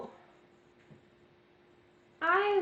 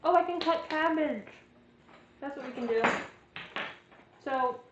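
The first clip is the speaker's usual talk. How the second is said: talking with a mouth full of food; chewing while talking; 4 dB louder than usual. Real, not acted. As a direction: speak around a bite of food. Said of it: "sorry, I'm chewing".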